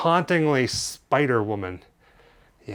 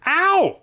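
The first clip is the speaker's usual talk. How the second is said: high sudden yelp of pain; real high ow; sharp ow; 13 semitones higher, 4 dB louder than usual. Real, not acted. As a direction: yelp at a paper cut. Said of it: "I got a paper cut".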